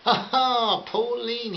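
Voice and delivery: high, loud hearty laugh breaking into the name; a man's delighted, booming laugh. A narrator's reading. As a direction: laugh out big and warm. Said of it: "he started to laugh loudly".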